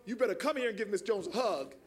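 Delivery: put-on low voice of a bossy older neighbor; Miss Virginia Jones. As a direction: order it in a deep, no-nonsense voice. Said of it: "And she goes".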